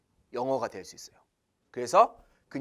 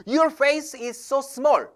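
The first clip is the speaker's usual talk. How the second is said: gushing compliment; 6 semitones higher, much slower than usual. Shown as a demonstration. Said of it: "안 돼요".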